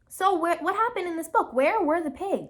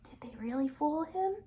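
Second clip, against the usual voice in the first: whispering; 8 dB quieter than usual. Real, not acted. Whispered question to the children.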